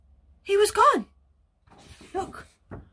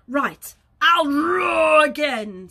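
loud and drawn out; insistent storybook bear, shouting; roaring bear; 3 semitones higher, 6 dB louder, much slower than usual. Acted. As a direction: burst into a roar on ROAR. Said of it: "said the bear".

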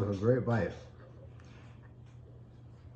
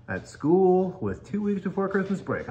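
ordinary talk

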